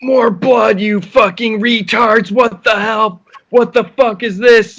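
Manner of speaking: high-pitched imitation of a screaming director; mock screaming. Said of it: "he'd always be screaming".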